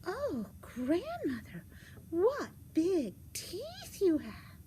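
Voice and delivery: put-on slow, high little-girl wonder; high, slow storybook girl. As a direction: stretch each word in high astonishment.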